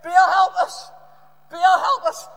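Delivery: very loud, high acted shout. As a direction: bellow a drawn-out plea. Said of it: "shouted even louder".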